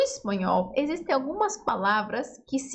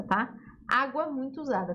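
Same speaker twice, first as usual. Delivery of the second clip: ordinary talk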